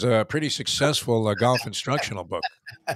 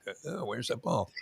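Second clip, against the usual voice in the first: a lightly voiced, softer golfer peering down the fairway; Arnie Palmer; 9 dB quieter than usual. Host imitates a golfer searching for his ball, softer light impression. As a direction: mutter it softly like a golfer squinting after his drive. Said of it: "you're doing the Arnie Palmer".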